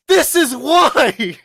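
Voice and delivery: slow, high, loud yell; outraged shout. Retold or imitated. Answering the classmates, slow high loud shout. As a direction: drag out the shout in triumph.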